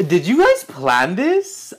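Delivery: gasp, then ask slowly and loudly; a stunned, drawn-out question; amazed question. Real, not acted. Surprised by matching gifts.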